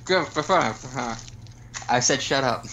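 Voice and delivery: talking around a cookie; mouth full. Real, not acted. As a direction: speak through a mouthful of cookie. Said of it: "don't talk with your mouth full".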